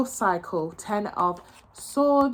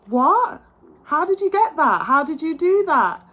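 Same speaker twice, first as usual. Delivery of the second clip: higher, louder stunned questions; mock amazement; 6 semitones higher, 5 dB louder, slower than usual. The doubters, hammed-up stunned surprise at her success. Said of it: "they're like".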